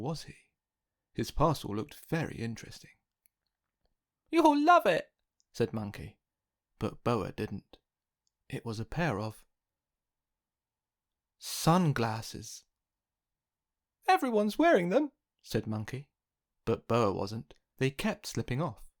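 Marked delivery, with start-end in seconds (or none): 4.3-5.1 much higher, louder cheeky monkey; excited Monkey. Acted. Monkey giving present. 14.1-15.1 much higher, louder cheeky monkey; excited Monkey. Acted. Monkey insisting.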